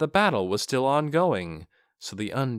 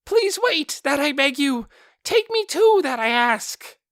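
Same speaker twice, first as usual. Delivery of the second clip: much higher, loud, pleading beast voice; a big magical beast begging to be taken along; high, loud, earnest creature cry; shrill beast calling; 6 dB louder than usual. Acted. Beast calls out.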